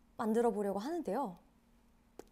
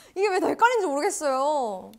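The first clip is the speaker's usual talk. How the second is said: ordinary talk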